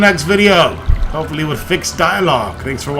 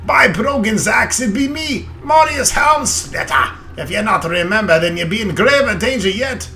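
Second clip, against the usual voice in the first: loud, higher hearty dwarf; 7 semitones higher, 4 dB louder than usual. Acted. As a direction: boom it out like an old dwarf. Reads dwarf lines in character.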